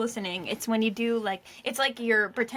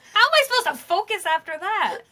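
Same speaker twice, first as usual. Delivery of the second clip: laughing; 8 semitones higher, 6 dB louder than usual. Real, not acted. Laughing after demon voice.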